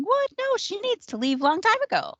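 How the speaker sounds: high-pitched, indignant retelling of a thought; mock inner wail; incredulous inner protest. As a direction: voice leaping up in exasperated disbelief. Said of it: "in my head, I'm going".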